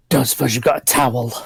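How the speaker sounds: low, quick in-character aside; wolf-man character voice. Acted. Shifted wolf-man asking a companion for something, low voice. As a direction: ask it low and fast, in character. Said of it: "looks over at Alyssa and goes".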